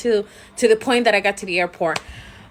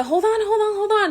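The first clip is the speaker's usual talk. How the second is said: urgent hold on; 11 semitones higher than usual. Retold or imitated. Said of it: "So I was telling Adia".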